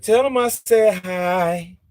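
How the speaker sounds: a high, slow mock of her voice; a light put-on impression of the woman; imitating her. Mimicking how she said hi to him. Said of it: "She said".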